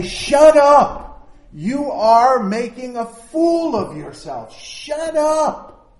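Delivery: high, sharp shout to shut him up; angry hush. A narrator's reading. Crowd scolding the blind man, loud shout.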